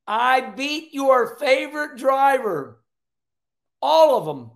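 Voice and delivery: loud, high, drawn-out mock taunt; imitated victory taunt. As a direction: crow it slowly at the whole crowd, imitating the winner. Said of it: "Denny Hamlin looks at all seventy thousand people".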